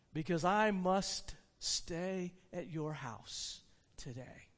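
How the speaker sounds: slow and quiet, fading away at the end; hushed declaration. Acted. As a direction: drop to a slow, soft voice. Jesus invites himself, voice drops.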